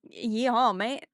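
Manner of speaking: loud yeehaw with a British mate; hammed-up cowboy call ending in mate; loud mock yeehaw, then a British mate; cowboy with a British slip. Shown as a demonstration. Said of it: "like, freaking, like".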